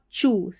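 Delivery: "चू" with a ch sound in place of t; TU as choo. Shown as a demonstration. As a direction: show "चू" as choo, not too. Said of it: "टी का उच्चारण ट नहीं है". ("चू" में ट नहीं, च की आवाज़)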